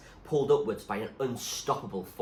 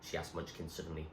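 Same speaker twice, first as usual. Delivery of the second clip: plain reading voice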